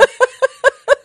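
loud squealing laugh; real delighted giggle; high giggle. Real, not acted. Laughs at own joke.

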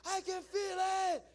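high-pitched scream, stretched out slow. Retold or imitated. Guy screaming over others' prayer, screaming. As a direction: imitate the screaming man, loud and long. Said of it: "the guy starts screaming".

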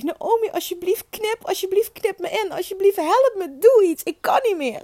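high-pitched retold pleading; desperate begging. Exhausted mother begging midwife to cut, desperate high plea. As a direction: beg high, at the end of endurance. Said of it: "dat ik tegen Naomi zeg".

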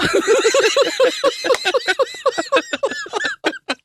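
long, high giggling fit; helpless laughter. Real, not acted. Podcast host losing it after his mock consolation, helpless laughter. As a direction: keep laughing, high and uncontrolled.

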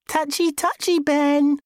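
high-pitched, taunting singsong; teasing. A narrator's reading. Housemate taunts him over the hidden diary, teasing. Housemate teases with obnoxious grin.

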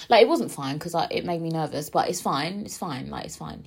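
usual speaking voice

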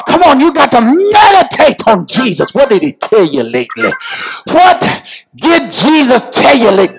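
high, urgent sermon building to a shout; full-force preaching. Real, not acted. Preacher asking congregation what Jesus told them, preaching at full force. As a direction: press the questions home, crying out to the climax.

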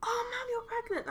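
soft, high imitation of a pupil; child's voice. Children notice teacher is pregnant, soft high voice. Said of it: "some of the children would be like".

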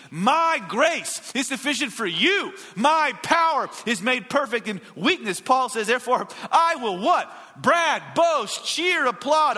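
high, slow, punched-out sermon delivery; fervent preaching. Acted. Pastor preaching Paul's words to his church, fervent preaching. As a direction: preach the verse at full force, drawing out key words. Preaches verse at full force.

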